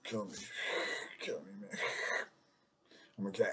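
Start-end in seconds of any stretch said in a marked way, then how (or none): 0.0-1.8 breathy whispered plea. Real, not acted. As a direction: whisper it in despair. Unvoiced whisper.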